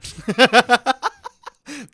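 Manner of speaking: loud, high burst of laughter. Real, not acted. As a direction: crack up out loud. Laughs at friend's reaction.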